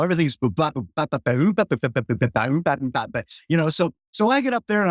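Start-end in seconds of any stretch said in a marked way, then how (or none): 0.7-3.2 scatting a funky slap bass groove; scatted bass line. Shown as a demonstration. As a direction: sing the slap bass part with "boo bop, bop". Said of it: "I was slap style".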